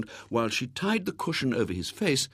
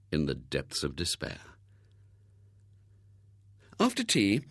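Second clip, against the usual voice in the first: ordinary delivery, nothing marked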